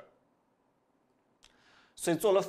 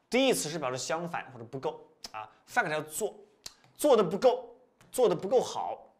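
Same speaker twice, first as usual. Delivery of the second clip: ordinary talk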